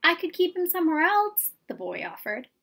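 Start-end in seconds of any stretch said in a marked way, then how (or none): 0.0-1.5 put-on higher, eager child's voice; a boy begging his mother to keep his cricket; pleading boy. A narrator's reading. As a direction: try to bargain hopefully.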